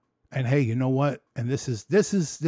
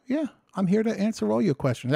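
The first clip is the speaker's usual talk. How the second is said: retelling his own reply; 9 semitones higher than usual. Retold or imitated. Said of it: "I says".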